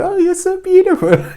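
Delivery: hammed-up squeal of cooing over a pet; high pet-talk voice. Imitating owners gushing to their pets, high-pitched. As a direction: voice climbing into loud, high baby talk. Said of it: "your voice gets higher".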